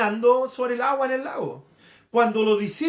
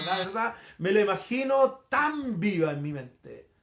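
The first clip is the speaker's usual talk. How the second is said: normal talking voice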